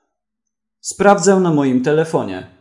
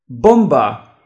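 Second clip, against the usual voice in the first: loud one-word alarm, falling; shout; 6 dB louder than usual. A narrator's reading. Wega warning everyone in the yard, loud shout. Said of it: "krzyknął Wega".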